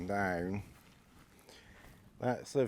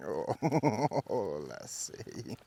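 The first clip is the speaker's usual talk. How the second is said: ordinary talk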